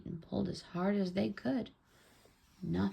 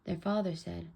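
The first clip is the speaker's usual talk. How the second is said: ordinary delivery, nothing marked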